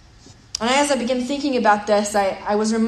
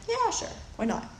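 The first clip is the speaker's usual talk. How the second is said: careless shrug; 11 semitones higher, 8 dB quieter than usual. Retold or imitated. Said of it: "And they're like".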